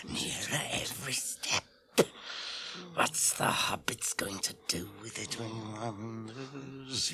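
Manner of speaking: hissing, whispering creature arguing with itself; Gollum muttering to himself. Acted. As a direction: wheedle and hiss to yourself like Gollum. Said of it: "He's talking with himself".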